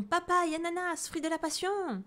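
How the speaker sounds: high, loud squeals of fruit names; excited monkey cries. Acted. Monkey bouncing on the board shouts his fruit wishes, high and excited.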